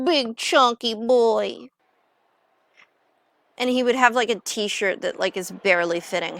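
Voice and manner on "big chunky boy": slow, playful doting emphasis; drawn-out relish. Pretend. Picturing Jack as a chunky villager, playfully drawn out. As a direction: savour each word, stretched right out.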